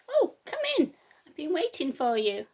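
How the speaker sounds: put-on high, loud cheery old lady; Grandma greeting who she thinks is her grandchild; sweet Grandma welcome.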